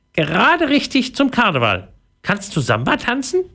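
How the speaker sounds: high, lively mouse voice; squeaky little voice. A narrator's reading. Local mouse welcoming Pauline to Brazil, high squeaky voice. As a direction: chirp it high like a tiny mouse. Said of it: "ein piepsendes Stimmchen".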